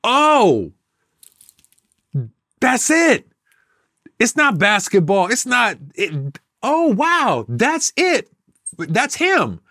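a hint of awed crowd, voiced up high; imitating the crowd. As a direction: play the amazed audience finally getting it. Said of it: "it was like they were saying".

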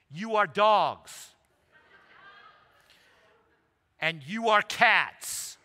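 very slow, loud mock declaration; blunt proclamation. Translator tells the congregation what they are, loud slow proclamation. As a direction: boom each word out at the whole congregation.

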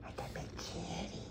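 breathy whispered hello. Real, not acted. Owner whispering to her big cat, whisper. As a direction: whisper it to the kitty.

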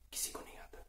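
near-whispered, trailing courtroom aside; hushed repetition. Acted. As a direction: drop to a soft, voiceless murmur. Repeats the point, hushed. (दबी, फुसफुसाती आवाज़ में)

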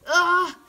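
short, loud yelp of alarm; startled yell. Real, not acted. Player startled in the game, loud yelp. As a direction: yelp as if jumped.

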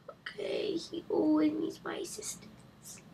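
muttered whisper under the breath; whispering a complaint about dad; whispered aside. Real, not acted. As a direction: grumble it softly so dad won't hear. Grumbling aside while dad calls.

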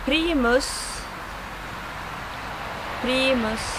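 Primus with Swedish vowels. Shown as a demonstration. Teacher showing bushcrafters the brand Primus, Swedish pronunciation. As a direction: model Primus in Swedish, word on its own. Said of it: "words that I should, uh, pronounce".

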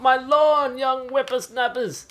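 put-on cranky old man yelling at kids; shouting at trespasser. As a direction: get off my lawn, grandpa style. Grumpy old man yelling at enemies.

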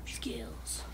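breathy whispered brag. Real, not acted. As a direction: whisper it smugly.

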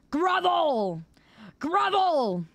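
high, drawn-out demand; mock tyrannical command. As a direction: cry it out imperiously. Demands groveling apology, escalating.

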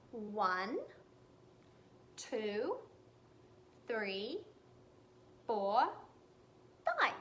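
ordinary delivery, nothing marked

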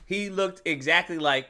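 ordinary delivery

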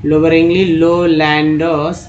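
लोअरिंगली stretched slowly, stress on the first syllable; slow word demo. Shown as a demonstration. Teacher modelling लोअरिंगली lowlanders slowly for the class. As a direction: drag out लोअरिंगली lowlanders carefully. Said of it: "एल वाई को ली बोलते हैं".

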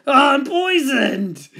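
loud high wail of disgust; howling. A narrator's reading. Dingo tasting the awful stew, howling. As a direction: howl in full, drawn-out distress. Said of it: "he howled".

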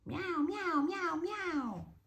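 very high gleeful meowing; happy meows. Acted. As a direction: meow with delight. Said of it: "kichert vor Freude".

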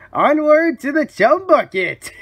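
high, loud proclamation; triumphant rallying cry. Acted. Villain leading his victim off, crying triumphantly. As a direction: call it out like leading a charge.

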